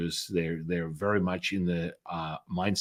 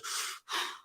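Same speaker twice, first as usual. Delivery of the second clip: long breathy smoker's sigh; smoky exhale sigh; 12 dB quieter than usual. Retold or imitated. Mother smoking, exhales.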